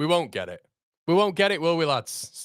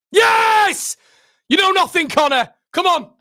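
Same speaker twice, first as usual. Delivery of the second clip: much higher, much louder yell of joy; real triumphant shout; 9 semitones higher, 8 dB louder than usual. Real, not acted. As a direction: roar it out as the save goes in. Fan celebrates a penalty save.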